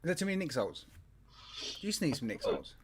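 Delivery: ordinary talk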